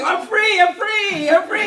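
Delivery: high, laughing calls for the ball; eager player calling. Acted. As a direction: mimic a teammate begging for a pass. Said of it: "It's like basketball. They're like".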